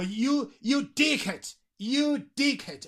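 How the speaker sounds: "You dickhead" pitched high, falling at the end; emphatic YOU. Shown as a demonstration. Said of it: "para ponerlo en énfasis". ("You dickhead" enfático, con tono alto)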